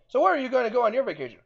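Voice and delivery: high, quick chummy question; playful captain making small talk; lightly voiced Kirk. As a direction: ask your officer in a bright rush. Kirk chatting to Spock, acted.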